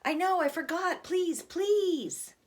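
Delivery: put-on high, pleading kid; begging little boy. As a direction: beg not to get in trouble. Boy begs his sibling.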